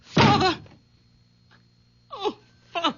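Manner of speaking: high, breaking cry fading to a sob; tearful. Acted. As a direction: let it crumble into tears. Daughter despairs as father leaves.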